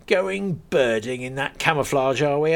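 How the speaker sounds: a friendly landowner laughing off his joke; lightly voiced farmer. Gunman teases Ian.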